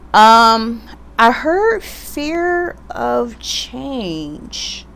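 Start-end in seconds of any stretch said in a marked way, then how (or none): 1.2-4.9 very slow and drawn out, higher; drawn-out repeat. Pretend. Reader repeating the channelled message to viewers, very slow and higher. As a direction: stretch every word out slowly.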